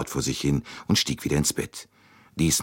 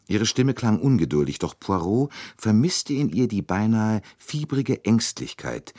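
ordinary delivery

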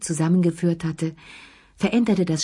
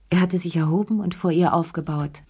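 usual speaking voice

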